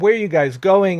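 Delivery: high, loud, upset demand; retold angry shout; upset yell. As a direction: call out at them in mock anger. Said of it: "he was very upset".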